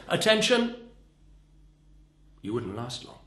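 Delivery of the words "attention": high, loud one-word order; barked command. Shown as a demonstration. What not to say standing up in an aircraft, barked. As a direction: call out "Attention!" like a sharp order. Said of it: "stand up in the middle of an aircraft and say".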